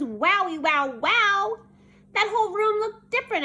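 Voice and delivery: high, loud, rising wonder; amazed. A narrator's reading. Child sees the rearranged classroom, amazed. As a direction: gasp out your amazement, building up.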